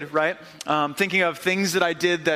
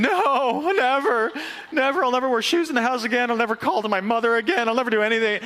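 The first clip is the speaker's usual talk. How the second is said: put-on pleading little boy; 5 semitones higher than usual. Caught boy pleading with his dad, high and desperate. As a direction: beg like a scared kid swearing never again. Kid promises dad never again.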